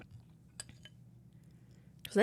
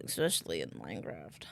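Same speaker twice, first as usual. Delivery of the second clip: low, quiet growl; deep voice; 13 semitones lower, 6 dB quieter than usual. Pretend. Repeats joke in other voice.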